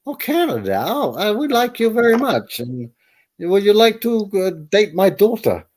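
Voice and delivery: lightly hammed-up eager New Zealander; eager friendly local. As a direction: local welcoming a Canadian, mock gushing. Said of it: "And I say".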